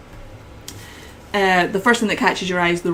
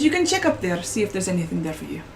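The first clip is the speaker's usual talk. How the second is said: put-on southern accent; faster than usual. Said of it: "And he says".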